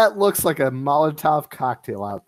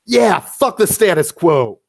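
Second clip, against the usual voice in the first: rowdy cheer; 4 semitones higher, 5 dB louder than usual. Acted. Crowd cheering after a speech.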